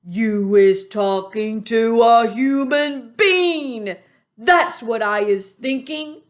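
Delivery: slow, loud brutish accusation; menacing giant. A narrator's reading. Bloodbottler accuses the BFG of hiding a human. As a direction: growl the accusation.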